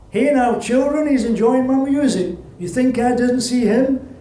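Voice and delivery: lightly voiced older woman holding court; a touch of a proud old accordion player; old accordionist woman. Accordionist speaks to children.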